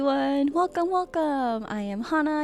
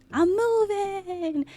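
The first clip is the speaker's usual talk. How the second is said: "I'm moving" said higher and slow, stretching "moving"; delighted; 7 semitones higher, slower than usual. Shown as a demonstration. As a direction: show off the new model with glee. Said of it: "everything has a new look, including me".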